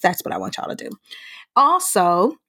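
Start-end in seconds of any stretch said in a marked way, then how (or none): 0.0-0.9 unvoiced whisper aside; playful whispered instruction. Real, not acted. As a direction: whisper it to the listeners. Aside to listeners.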